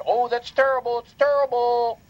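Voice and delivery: high, mock-sympathetic whine; mocking fake concern. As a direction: parody their hollow sympathy. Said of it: "every time a little girl gets hurt".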